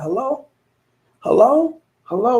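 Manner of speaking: rising, puzzled hellos into a silent phone; calling hello. Retold or imitated.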